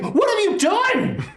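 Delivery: high-pitched, horrified yell; alarmed character outcry. Acted. Game master voices Merrick reacting to the ripple, high alarmed cry. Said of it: "And Merrick's like".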